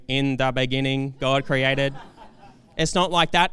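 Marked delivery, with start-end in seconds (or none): 0.1-1.9 monotone robotic text reader; computer-generated voice. Acted. Preacher mocking the Bible app voice he avoids, computer-generated monotone. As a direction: recite it like a machine, every word level. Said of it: "not like some computer-generated voice saying".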